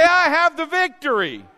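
loud confident proclaiming; bold declaration. Shown as a demonstration. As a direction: claim it out loud. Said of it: "say it again like you mean it".

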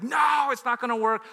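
loud, high exasperated shout; acted cry of refusal; inner outburst. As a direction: shout the retold protest from inside your head. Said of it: "on the inside I was like".

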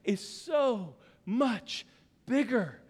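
slow, drawn-out declaration; God declaring. Acted. God telling the believer he is greater, slow. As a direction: stretch each word like a divine promise. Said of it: "That's what he's saying is".

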